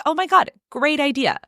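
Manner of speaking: high-pitched delighted exclamation. Acted. Girlfriend welcoming her boyfriend's new fish idea, delighted. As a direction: gush with enthusiasm. Acting Christy's delighted reply.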